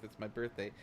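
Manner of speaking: ordinary talk, nothing marked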